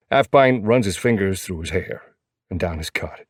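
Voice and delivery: ordinary delivery, nothing marked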